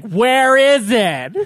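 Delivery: slow, drawn out question; lightly imitated puzzled; mock puzzled. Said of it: "He's like".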